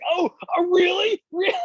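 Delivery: high squeaky really?; mock disbelief, voice cracking up; the driver hearing it was his first time, stunned; incredulous. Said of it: "I was like".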